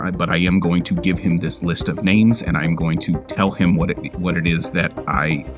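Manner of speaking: ordinary talk, nothing marked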